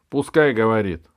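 loud, dismissive permission; smirking king. A narrator's reading. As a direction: grant it with amused royal scorn. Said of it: "усмехнулся король".

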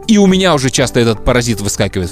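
ordinary talk

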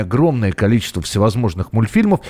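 normal talking voice

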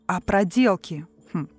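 ordinary talk, nothing marked

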